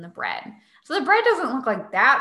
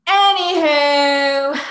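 drawn out; 5 semitones higher, 8 dB louder, much slower than usual. Real, not acted. Drawn-out topic change.

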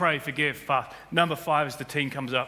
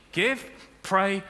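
ordinary talk

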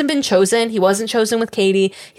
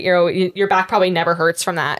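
ordinary delivery

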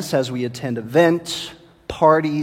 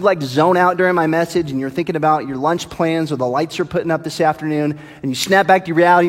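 usual speaking voice

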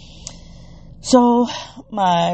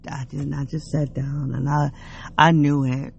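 usual speaking voice

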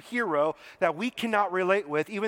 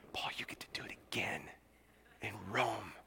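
very low, near-whispered words; hushed reading; 11 semitones lower, 14 dB quieter than usual. A narrator's reading. Preacher reads the Lord's words to Paul, hushed near-whisper. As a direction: let it drop almost to a whisper.

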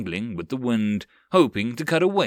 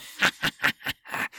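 goblin laugh. Acted. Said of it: "a laughing goblin".

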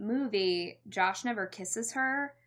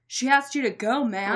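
Josh's bit; 4 semitones higher, 8 dB louder than usual. Retold or imitated. Josh voiced in a retold scene.

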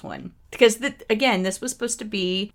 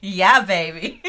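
slow, louder, laughing; a light Austin Powers imitation; Austin Powers catchphrase; 6 dB louder, slower than usual. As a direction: drawl it like a groovy spy. Catchphrase after Austin Powers remark.

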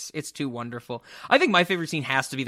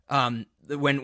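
ordinary talk

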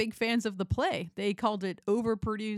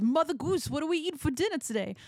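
higher, quicker silly goose voice; goose man voice; 7 semitones higher than usual. Retold or imitated. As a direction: play the goose guy asking for dinner. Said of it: "he goes".

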